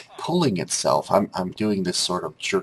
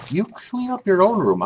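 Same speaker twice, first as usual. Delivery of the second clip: higher, louder scolding; a hint of an exasperated father; annoyed dad; 13 semitones higher, 5 dB louder than usual. Dad telling son to clean his own room, mildly irritated.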